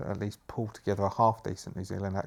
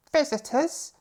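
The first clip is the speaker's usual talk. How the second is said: high, raised questioning voice; maid's voice; 7 dB louder, slower than usual. Acted. Maid greets the butler and guests, high questioning voice. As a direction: pitch it well up as the maid. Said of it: "asks the woman".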